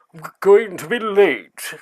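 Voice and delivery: slow, high, loud ghostly call. Acted. Ghost floating past worries about lateness, high wailing call. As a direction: wail it out slowly like a passing ghost. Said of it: "you can hear".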